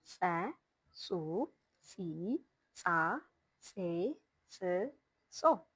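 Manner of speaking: "Tse, Tsu, Tsi" with a burst of throat air through a small hole; ejective ts sounds. Shown as a demonstration. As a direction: say each "Ts" syllable alone, air pressed from the throat. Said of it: "an air press from the throat through a small hole".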